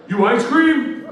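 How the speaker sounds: high, loud called-out question; booming challenge. Retold or imitated. Huge neighbor walking up to ask who he is, hollering. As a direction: imitate a big neighbor hollering over. Big neighbor calls out to him.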